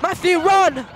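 panicked yelling. Real, not acted. Friend caught by ghost.

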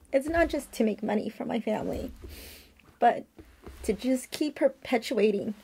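holding back tears while thanking; emotional. Real, not acted. A vlogger fighting tears, sorry for those lost. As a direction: voice lifting and strained with real emotion. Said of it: "I'm getting a little emotional".

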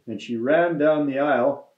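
plain reading voice

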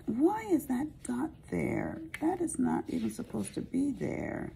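high, soft puzzled muttering at a stray dot. Real, not acted. Crafter puzzling over a stray dot on her page, high and soft. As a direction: wonder aloud why that dot is there.

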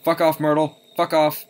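loud, forceful shout; loud repeated warding-off yell; alarmed shouting. Real, not acted. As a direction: shout the threat away. Yelling at game ghost Myrtle.

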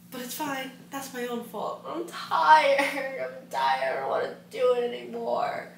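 high, drawn-out playful whine; hammed-up self-pity, pitched well up; mock whining. Complaining to herself about the late-night work, mock whining.

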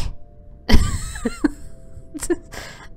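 high, breathless squealing laugh; burst of high laughter. Real, not acted. Player squealing at her own failing plan, loud high laugh. Frantic gameplay moment.

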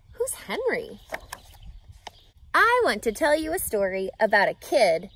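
ordinary delivery, nothing marked

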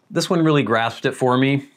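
ordinary talk, nothing marked

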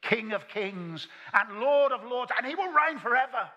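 loud, high, ringing declamation; building to a high, loud climax; choir's proclamation. Retold or imitated. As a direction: proclaim it like a triumphant chorus. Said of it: "where they sing about Jesus".

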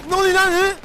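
high, loud startled shout. Real, not acted. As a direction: yell it in sudden shock. Shocked shout in game.

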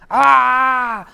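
loud pouncing cry; wolf roar. Acted. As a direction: burst out with a roar. (cri du loup qui bondit)

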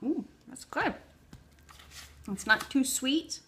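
chewing while talking; just swallowing a bite; mouth partly full. Real, not acted. Seen 0: Spoonful into her mouth at -4 to -2; jaw works during words.

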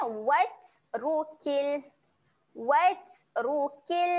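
slow "Watrukil" with breathy t; recited; Quran recitation. Shown as a demonstration. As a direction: recite it slowly, let air out on t. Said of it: "keluar angin".

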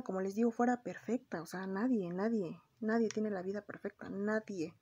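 ordinary talk, nothing marked

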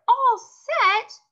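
high, loud joyful shout; joyful holler. Acted. Junie B. hollering to Mother, high joyful shout. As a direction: holler it with pure joy. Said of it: "I hollered real joyful".

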